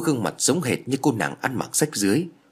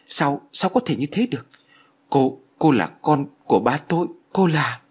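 higher, halting horrified voice; 5 semitones higher than usual. A narrator's reading. Hậu learning she is his father's daughter, horrified. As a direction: stammer it out in rising shock. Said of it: "Hậu kinh hãi".

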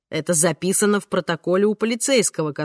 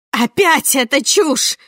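higher, louder, slow gasping cry; breathless shout; 7 semitones higher, 5 dB louder, slower than usual. A narrator's reading. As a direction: gasp it out as a shout. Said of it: "задыхаясь, выкрикнула Амандина".

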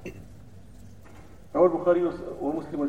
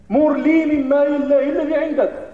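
loud, slow and demanding; rude Bedouin demand; 8 semitones higher, 12 dB louder, slower than usual. Retold or imitated. Preacher imitating the Bedouin demanding money from the Prophet, loud and rude. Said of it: "ثم اش قال ليه؟".

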